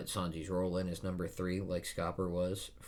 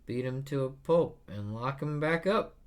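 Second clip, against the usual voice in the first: higher, louder, carefree boast; Luffy voice; 7 semitones higher, 5 dB louder than usual. Acted. Luffy casually vowing to beat Loki, higher and louder. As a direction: toss it off like a cocky kid. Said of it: "Luffy's just like".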